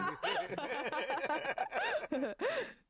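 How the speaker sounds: high burst of real laughter. Real, not acted. Cohost cracks up at the mum impression, high real laugh. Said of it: "Your Nigerian accent is".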